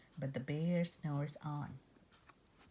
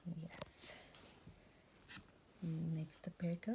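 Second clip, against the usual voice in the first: hushed unvoiced aside; tiny secret aside; whisper; 11 dB quieter than usual. Real, not acted. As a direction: whisper a quick yes. Aside to listener.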